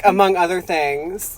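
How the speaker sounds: usual speaking voice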